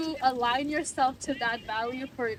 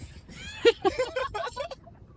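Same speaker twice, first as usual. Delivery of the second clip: high laugh; 13 semitones higher than usual. Real, not acted.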